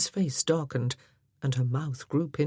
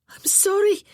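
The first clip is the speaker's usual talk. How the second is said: much higher, louder tearful blurt; blurted, close to tears; 7 dB louder than usual. A narrator's reading. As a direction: burst out with an apology on the edge of crying. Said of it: "she blurted out, looking like she was about to cry".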